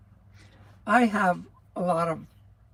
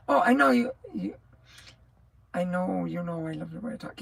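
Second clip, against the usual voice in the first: ordinary talk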